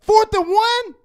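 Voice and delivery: high-pitched incredulous question; angry disbelief. Real, not acted. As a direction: throw it out in exasperated disbelief. Angry disbelief at the play call.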